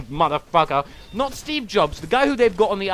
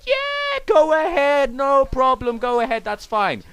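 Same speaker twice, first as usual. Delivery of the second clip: breezy station clerk; 6 semitones higher, 3 dB louder, slower than usual. Retold or imitated. Station worker reassures him.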